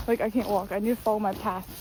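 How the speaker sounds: ordinary delivery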